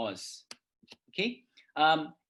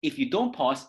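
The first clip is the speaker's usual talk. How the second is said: ordinary talk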